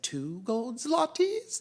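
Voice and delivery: slow, high rising question; drawn-out incredulous query; tempted surprise. A narrator's reading. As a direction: repeat the offer in wonder. Weary cats tempted by doubled money.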